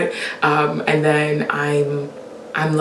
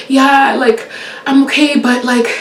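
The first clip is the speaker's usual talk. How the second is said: high, louder anxious asking; panicky caller; 8 semitones higher, 5 dB louder than usual. Retold or imitated. Caller asking the dispatcher again, high and anxious. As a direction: fret loudly with a laugh.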